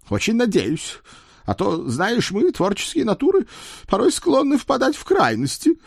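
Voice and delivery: very high, strained horse voice; growl through clenched teeth. A narrator's reading. Blackmailing horse warns his rider, high growl through clenched teeth. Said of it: "прорычал он, не размыкая зубов".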